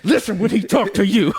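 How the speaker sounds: loud, high put-on cat voice; scolding cat. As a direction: lay down the law as the cat. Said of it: "He said".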